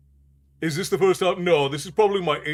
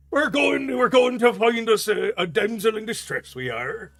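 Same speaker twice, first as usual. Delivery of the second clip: pitched-up sailor dragon in pirate brogue; comic pirate sing-out; 8 semitones higher, 3 dB louder than usual. Acted. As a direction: play a hammed-up pirate dragon, voice lifted high. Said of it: "They just all sound like pirates".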